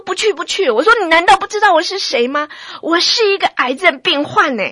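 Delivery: loud, high furious shout; furious yell refusing the husband; roaring at husband. Retold or imitated. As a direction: hurl it at him, out of control. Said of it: "很大声的对我先生咆哮".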